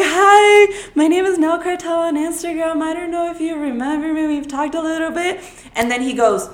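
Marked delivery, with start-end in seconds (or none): 0.0-5.4 nervous fan introducing herself to industry people; nervous high greeting. Retold or imitated. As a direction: blurt it out nervously, pitched up.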